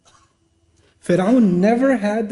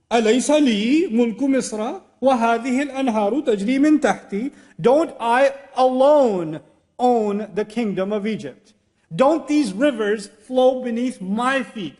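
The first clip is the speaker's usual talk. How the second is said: arrogant Pharaoh; 4 semitones higher than usual. Retold or imitated. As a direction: lord it over them like a king. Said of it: "That's how he talks".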